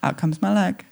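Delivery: normal talking voice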